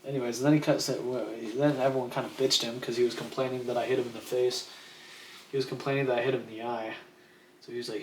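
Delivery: ordinary delivery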